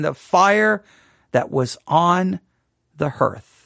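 slow, weighty reading with pauses; solemn scripture reading. Acted. Preacher reading the roll's burning aloud, solemnly. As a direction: let each phrase land gravely.

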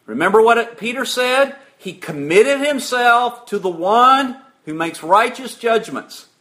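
ordinary talk, nothing marked

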